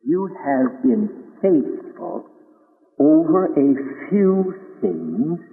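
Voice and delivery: slow, deliberate blessing; slowly, weighing each word; solemn slow words of God; slow solemn quote. Acted. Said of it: "So he says".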